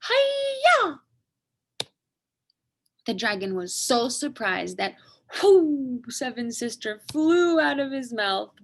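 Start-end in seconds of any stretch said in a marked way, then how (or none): 0.0-1.0 very high, loud battle cry; karate shout. Acted. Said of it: "and shouted". 5.4-6.0 high, loud whooshing call. Acted. Baby flies out of mouth.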